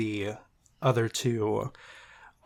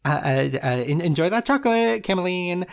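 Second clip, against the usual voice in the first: fairy voice; 7 semitones higher, 4 dB louder, faster than usual. Acted. Tooth Fairy voice again.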